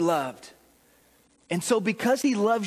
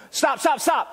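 a hint of a coach calling stop, a little higher; mock coach calling stop; coach stopping practice; 5 semitones higher than usual. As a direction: call a halt like a coach. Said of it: "the coach blows the whistle".

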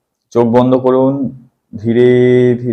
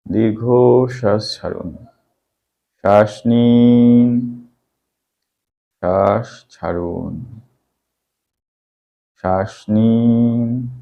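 drawn-out, slow breathing instructions; slow breathing cues; much slower than usual. Acted. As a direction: stretch each cue out slowly.

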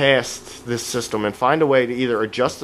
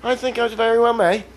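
put-on a British accent, pitched higher; playful put-on British accent; 8 semitones higher than usual. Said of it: "Should I talk British".

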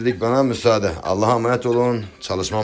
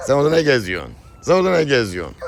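raised, louder scolding question; 5 semitones higher, 5 dB louder, faster than usual. Real, not acted. Owner asks animal what it's doing there, loud scolding. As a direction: tell off a wandering animal, pitched up.

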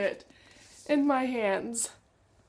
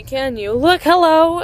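normal talking voice

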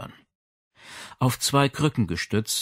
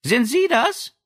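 much higher bright question; high girlish voice; 14 semitones higher, 4 dB louder, slower than usual. Acted. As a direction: ask it lightly, like a young girl. Madame Berger's girlish voice.

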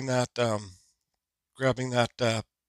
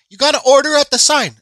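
high mock voice of the cashier, pitched up; imitating the cashier; 11 semitones higher, 8 dB louder than usual. Retelling the hot dog cashier's order, mock high voice. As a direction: put on the cashier's voice, higher and a bit louder.

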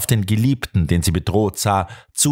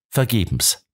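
ordinary delivery

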